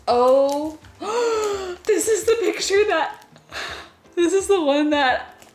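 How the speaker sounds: overjoyed recognition, breathless; excited gasp. Real, not acted. Recognizing the painting in package.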